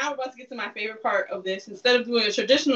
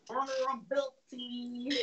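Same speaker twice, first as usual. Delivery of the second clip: through your teeth; 12 dB quieter, slower than usual. Shown as a demonstration. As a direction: hiss Vulnerability through clenched teeth. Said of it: "That's how you gotta say through your teeth".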